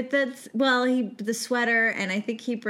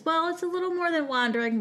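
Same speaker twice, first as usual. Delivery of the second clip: normal talking voice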